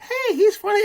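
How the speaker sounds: put-on high squeaky taunting kid. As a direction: tease the dog like a shrill little kid. Voicing kid teasing dog.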